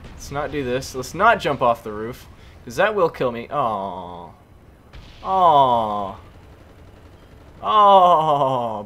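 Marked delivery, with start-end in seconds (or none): 3.5-8.8 louder drawn-out groan of dismay. Real, not acted. Gamer groaning at his teammate after dying, loud dismay. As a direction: let the disappointment spill out.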